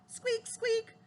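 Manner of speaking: high, small squeaky voice; mouse squeaking. A narrator's reading.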